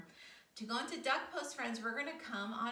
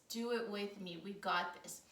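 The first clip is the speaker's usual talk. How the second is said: ordinary delivery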